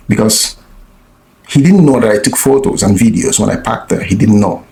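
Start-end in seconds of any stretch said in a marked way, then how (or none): none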